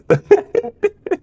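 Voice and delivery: shrill rolling ostrich squawk; ostrich noise. Shown as a demonstration. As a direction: let the ostrich call roll out. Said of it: "Vas-y".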